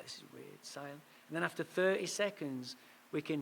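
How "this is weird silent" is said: whisper. Retold or imitated. Awkward silence on the phone call.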